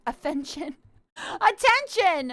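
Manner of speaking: usual speaking voice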